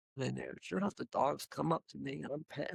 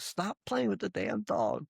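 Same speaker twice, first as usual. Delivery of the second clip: mild mock yell, higher and slow; slower retold yell; 7 semitones higher than usual. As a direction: drag the scolding out in a lightly imitated boss voice. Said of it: "Brian would always yell at me".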